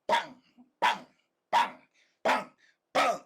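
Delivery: loud, sharp, evenly spaced imitated punches; punch sound effects. Shown as a demonstration. Storyteller shows how he was hitting his face, punchy bursts. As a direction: fire off each impact as a separate burst. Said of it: "This is how he was hitting his face".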